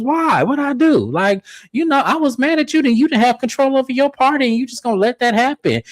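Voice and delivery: high hurt mock voice; imitating Glenn. Glenn blaming Cliff for the party, high mock whine. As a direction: whine it like a guy feeling betrayed. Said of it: "He goes".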